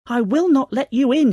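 loud, high storybook pig shout; defiant shout. A narrator's reading. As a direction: shout a refusal at the wolf. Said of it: "he shouted".

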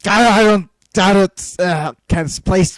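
loud high stammering; flustered outburst. Real, not acted. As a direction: blurt it out overwhelmed.

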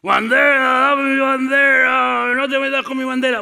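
grito de zombi muy agudo y estirado; aúlla cada palabra alargando las vocales; zombi obsesionado. Acted. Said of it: "Son como zombies". (zombi gritón, agudo y lento)

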